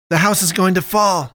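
alarmed shout; yelling. A narrator's reading. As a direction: yell a warning over the chaos. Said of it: "Dushan yelled".

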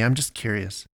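usual speaking voice

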